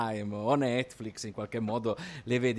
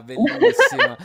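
excited; 13 dB louder than usual. Real, not acted. Enthusing about the series.

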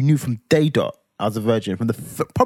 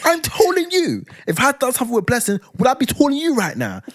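losing it. Retold or imitated. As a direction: retell the row at full blast, much higher. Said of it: "I'm just losing it".

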